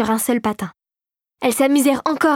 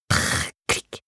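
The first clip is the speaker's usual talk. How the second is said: whispered mechanical whirr and click; imitated whirr and click; 6 dB quieter than usual. A narrator's reading. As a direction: voice the clockwork key. Machine key turning noise.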